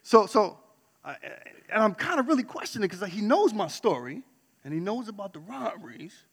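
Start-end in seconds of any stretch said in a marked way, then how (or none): none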